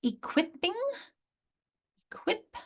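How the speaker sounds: "Equipping", "equip" with high QUIP; "Equipping" as e-QUIP-ping, high; second-syllable stress. Shown as a demonstration. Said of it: "where is your strong syllable".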